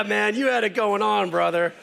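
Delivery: usual speaking voice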